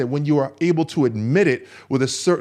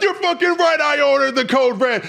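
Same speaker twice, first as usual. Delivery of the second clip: high, loud defiant shout; Colonel Jessup; 14 semitones higher, 5 dB louder than usual. Retold or imitated. Cornered colonel admitting he ordered the code red, furious shout. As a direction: yell the confession back with defiance. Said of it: "to Colonel Jessup".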